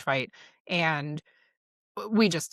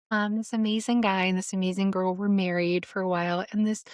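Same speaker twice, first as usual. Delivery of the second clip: mock nice retelling; 3 semitones higher than usual. Shown as a demonstration. Retelling the affair story the nicest way possible, mock sweet. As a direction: tell it in an exaggeratedly nice way. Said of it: "say it in a really nice way".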